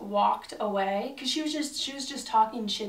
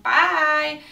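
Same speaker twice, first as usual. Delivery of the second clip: loud bye; 6 semitones higher, 9 dB louder than usual. Retold or imitated. Quitting, throwing things on counter.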